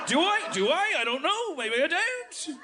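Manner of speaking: high-pitched hammed-up Aussie questions; Australian uptalk. Shown as a demonstration. Comic parodying the accent, high questioning Australian lilt. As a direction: turn every phrase up, Australian-style. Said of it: "everything's a question".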